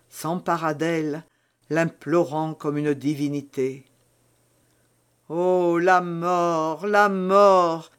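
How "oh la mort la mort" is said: slow, veiled lament swelling to a louder cry; a muffled, slowed moan of death. Acted. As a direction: hide the voice, then let the cry break out. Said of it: "en voilant sa voix".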